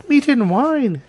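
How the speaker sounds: put-on high, innocent little-girl voice. A narrator's reading. Girl thanking the wolf, high innocent voice. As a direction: answer politely in a high girlish pitch.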